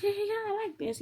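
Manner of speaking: high, eager little yeah-yeah; keen self-quote. Retold or imitated. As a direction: act out liking it, pitched right up. Acts out her growing keenness.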